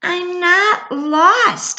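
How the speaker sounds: very high, loud and slow fawn; a little one insisting; insistent fawn. Acted. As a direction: fawn protesting it isn't lost. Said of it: "said the fawn".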